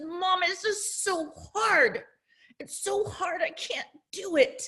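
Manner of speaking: lightly voiced whine of a child at his limit; frustrated child. Son to his mom over math homework, mildly whiny frustration. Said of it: "It was so frustrating".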